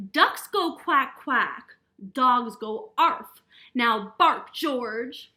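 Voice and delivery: loud, slow, exasperated correction; frustrated mother. A narrator's reading. Mother whose puppy won't bark, loud slow frustration. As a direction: drum it in loudly, word by word.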